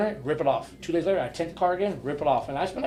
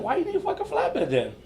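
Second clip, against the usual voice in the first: high, laughing question; laughing incredulity; 12 semitones higher than usual. Retold or imitated. Tow driver mocking her, high and laughing. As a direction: laugh through the complaint. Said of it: "I was like".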